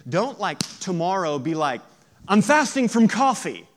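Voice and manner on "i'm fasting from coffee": mildly hammed-up loud announcement; mock complaint. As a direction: announce it high and loud, mocking. Mimics a grouchy coffee faster.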